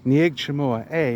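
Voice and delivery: Khmer phrase said slowly, syllable by syllable; slow careful Khmer. Shown as a demonstration. Learner teaching viewers a Khmer phrase, slow and careful. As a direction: spell out "Nieg Chamua A" carefully. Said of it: "it's literally, "You name what?".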